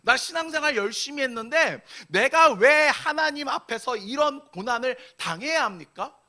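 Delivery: complaint building to a loud, high protest; arguing with God. Acted. Struggling believers asking God why, increasingly argumentative. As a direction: start aggrieved, build to indignant questioning. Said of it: "따지기 시작합니다".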